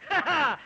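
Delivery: triumphant stage laugh; villainous laugh. Acted. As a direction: laugh like a gloating mythological king. Yama laughs at his threat.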